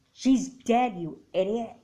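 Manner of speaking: sudden loud, furious snap; angry outburst. Acted. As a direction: throw the blame at her hard. Mom furious at daughter.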